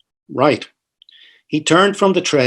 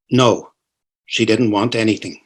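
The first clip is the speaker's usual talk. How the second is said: ordinary delivery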